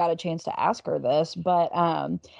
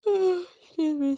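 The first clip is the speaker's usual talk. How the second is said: talking through a yawn; slow, high words stretched by a yawn; 12 semitones higher, much slower than usual. Real, not acted. Co-host interrupts her own story about mom, yawning. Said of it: "per episode yawn".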